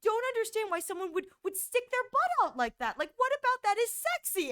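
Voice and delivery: high, baffled mock-mom voice; a confused mother pitched well up; mimicking mom. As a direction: daughter voicing mom's bewilderment. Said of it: "she was like".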